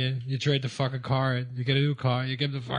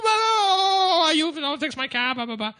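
angry cabbie; 12 semitones higher than usual. Retold or imitated. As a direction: imitate the angry Haitian driver, whining.

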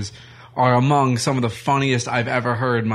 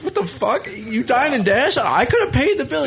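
shocked friend; 11 semitones higher than usual. Retold or imitated. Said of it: "Simon's like".

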